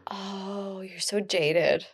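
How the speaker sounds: a quiet send-up of the date; mock jaded. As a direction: mimic him, drawn out and quiet. Mocking the date's words.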